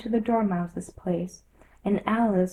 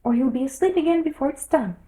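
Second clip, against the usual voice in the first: impatient Hatter; 6 semitones higher, 5 dB louder, faster than usual. A narrator's reading. Hatter voiced, hurrying.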